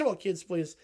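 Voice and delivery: usual speaking voice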